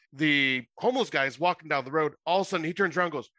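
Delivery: ordinary delivery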